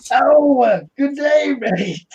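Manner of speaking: g'day mate like a hammed-up Australian; over-the-top Australian accent, pitched high; mock Australian accent. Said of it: "maybe my Australian accent".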